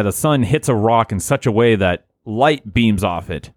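normal talking voice

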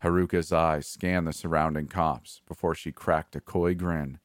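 usual speaking voice

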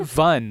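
vun said with a V for one; mock vun. The cohost mocks her accent, vun with a V. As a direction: quote her vun back with a V sound. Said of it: "There's no W there".

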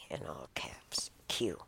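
ordinary talk

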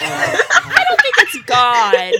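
slow laughing goat voice. Acted. Goat laughs denying godhood.

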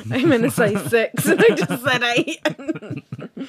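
helpless laughter through the words; laughing. Real, not acted. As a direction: confess the slip while cracking up. Laughing at own scoring slip.

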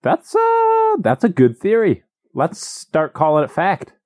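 high, drawn-out wow; mock amazement. Real, not acted. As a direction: act hugely impressed.

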